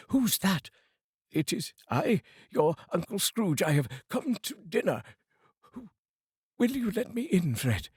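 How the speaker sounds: high, eager and hopeful; eager cheer. Acted. Scrooge asking his nephew Fred to let him in to dinner. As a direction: ask to be let in, beaming.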